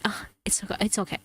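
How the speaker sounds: imitating a half-whispered retreat; mock backing off. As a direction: back out softly, mimicking someone dropping the subject. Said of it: "And then you just go like".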